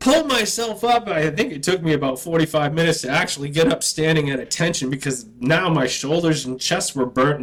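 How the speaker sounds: ordinary talk, nothing marked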